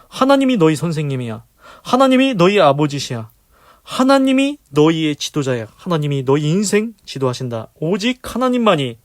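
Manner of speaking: loud, high impassioned sermon; fervent preaching. Acted. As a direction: preach it to the congregation with fire. Preaching at full force.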